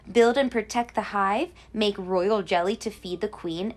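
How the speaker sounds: usual speaking voice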